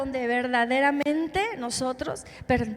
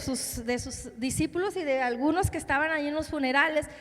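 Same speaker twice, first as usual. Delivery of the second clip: ordinary delivery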